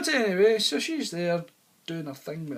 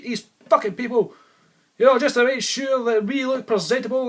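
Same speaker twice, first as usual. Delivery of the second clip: louder, higher, indignant mock tirade; imitating wife's furious rant; 7 semitones higher, 8 dB louder than usual. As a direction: blast him like the offended wife. Wife blows up at husband.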